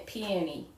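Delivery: "peony" tried as PEE-eh-nee; tentative "peony", no long O; attempted other pronunciation. Shown as a demonstration. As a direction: softly copy "peony" with a short middle vowel. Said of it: "can't even say it the other way".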